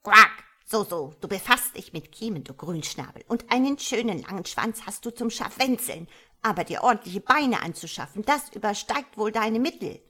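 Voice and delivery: explosive quack, then sarcastic scolding; a touch of trembling frog wrath, loudest at start and end; angry. A narrator's reading. Frog mother belittles her tadpole's body, angry. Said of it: "bebte vor Zorn".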